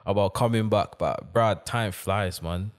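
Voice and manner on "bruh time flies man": usual speaking voice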